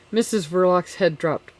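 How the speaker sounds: ordinary delivery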